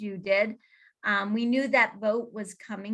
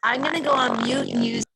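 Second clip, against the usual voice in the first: echoey audio; 3 dB louder than usual. Real, not acted.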